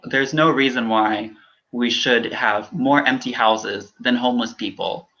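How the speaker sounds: ordinary talk, nothing marked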